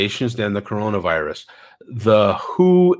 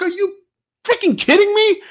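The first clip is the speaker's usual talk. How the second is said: much higher, incredulous, disgusted; retold outrage at a coughing shopper; exasperated disbelief. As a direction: throw it at her like you can't believe it. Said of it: "I looked at her like".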